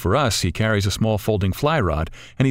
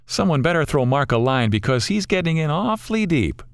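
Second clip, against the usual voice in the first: higher-pitched mock warning; sarcastic wisecrack, pitched up; sarcastic quip; 6 semitones higher than usual. A narrator's reading. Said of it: "Rich sarcastically quips".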